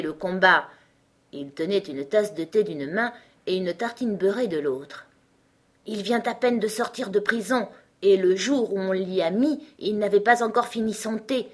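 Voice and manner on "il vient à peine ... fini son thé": plain reading voice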